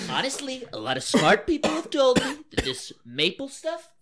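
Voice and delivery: mock Trump. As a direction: brag like Trump. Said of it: "the old Trump accent".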